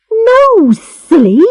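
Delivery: put-on high, exasperated girlish cry; exasperated girl's exclamation. A narrator's reading. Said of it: "she cried".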